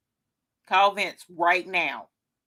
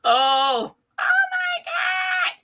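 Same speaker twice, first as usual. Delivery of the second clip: excited squeal; much slower than usual. Real, not acted. Gasps at the sparkle.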